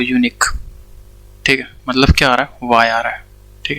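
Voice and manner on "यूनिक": यूनिक starting with a y glide, yoo-nik; unique pronunciation. Shown as a demonstration. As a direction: say यूनिक beginning with the y sound. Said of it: "इस तरह से pronounce करते हैं". (यूनिक, य की ध्वनि से)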